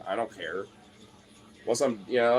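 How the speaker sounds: ordinary talk, nothing marked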